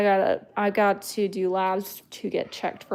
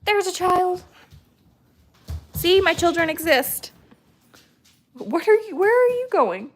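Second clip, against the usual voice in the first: high playful voice; 10 semitones higher, 5 dB louder than usual. Real, not acted. Calling to child playfully.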